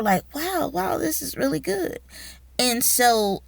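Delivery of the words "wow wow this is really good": high, hushed wonder; softly awed, pitched up; impressed amazement. Retold or imitated. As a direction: imitate colleagues marvelling at good work. Acted impressed colleagues reading essays.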